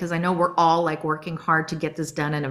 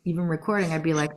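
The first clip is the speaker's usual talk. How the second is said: ordinary talk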